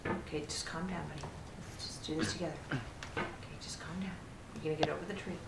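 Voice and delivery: soft, low, calming murmur; self-soothing. Real, not acted. Player calming the buddy before the tree, soft reassurance. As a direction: talk yourself down gently.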